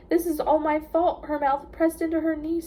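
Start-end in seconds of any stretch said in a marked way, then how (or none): 0.1-1.2 stifled sob. A narrator's reading. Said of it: "stifling her words".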